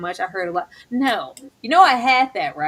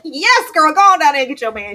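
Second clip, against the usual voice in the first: louder, fast hype cheer; loud, quick encouraging shout; 4 semitones higher, 7 dB louder than usual. Retold or imitated. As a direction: cheer her on loudly like a hype friend. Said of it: "I was like".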